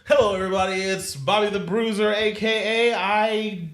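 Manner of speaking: high, loud hyped nineties announcer; fighting game announcer. Pretend. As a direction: pump it up like a nineties sportscaster. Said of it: "Like the nineties voice that comes on".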